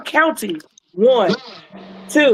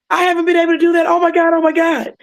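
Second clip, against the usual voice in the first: high, excited disbelief; lightly voiced patient overjoyed; retelling her thrilled cry; amazed joy; 5 semitones higher, faster than usual. Patient amazed she walked.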